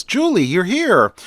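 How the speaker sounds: light up at seeing a welcome visitor; delighted greeting. A narrator's reading. Bruce greeting Julie at his door, delighted. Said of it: "His face lights up".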